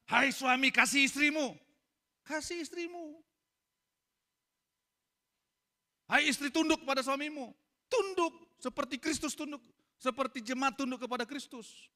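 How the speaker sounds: high, loud scripture proclamation; fervent preaching. Real, not acted. Preacher proclaiming the command to husbands and wives.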